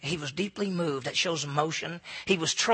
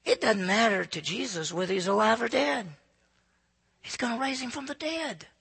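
ordinary talk, nothing marked

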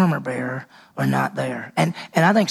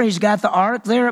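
ordinary talk

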